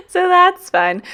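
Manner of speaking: high squealing laugh into the words, slow. Real, not acted. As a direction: laugh it out, tickled by the joke.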